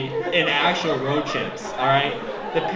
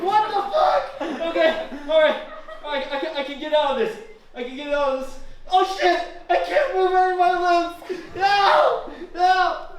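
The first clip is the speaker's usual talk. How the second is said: teddy bear panicking on the floor, crying out. Pretend. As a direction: panic like a toy being chewed apart. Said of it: "it's like this on the ground".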